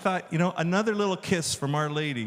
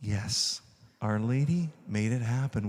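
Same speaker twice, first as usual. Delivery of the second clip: usual speaking voice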